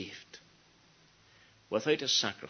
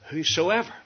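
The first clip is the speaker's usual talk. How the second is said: Whosoever lifted higher, with a rise; stress on Whosoever; 6 semitones higher, 5 dB louder than usual. Retold or imitated. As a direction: hold up the word Whosoever. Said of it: "emphasizing this grand word".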